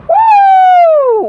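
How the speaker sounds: long, piercing high "woo"; high loud whoop. Acted. Husband reacting to an insult to his wife, high loud whoop. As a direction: let out a sustained high whoop. Whoop before confronting the attendant.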